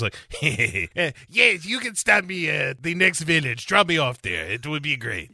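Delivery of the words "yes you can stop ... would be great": put-on ingratiating castaway in a thick mixed accent; hammed-up Spanish-French accent; Jon Voight's muddled accent. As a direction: ask a favour in a rowdy, wandering accent. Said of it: "So Jon Voight jumps onto the boat".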